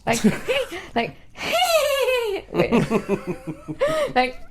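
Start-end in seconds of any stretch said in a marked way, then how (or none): 0.3-0.9 high cackling witch laugh; witchy cackle. Shown as a demonstration. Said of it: "I sound like a witch".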